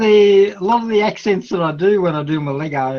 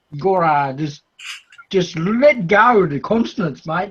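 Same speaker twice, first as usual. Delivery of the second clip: normal talking voice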